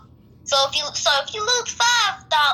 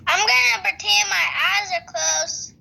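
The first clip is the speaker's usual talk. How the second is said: ordinary delivery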